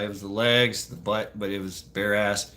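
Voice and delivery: ordinary delivery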